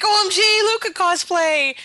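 very high, loud gushing; acted fan squeal. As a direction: squeal it like a thrilled fan spotting a costume. Acts out group's fan reaction.

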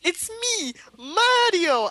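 very high-pitched cartoon Italian; hammed-up high Italian-accented catchphrase; Mario. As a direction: squeal it out like a game mascot. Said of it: "he's like".

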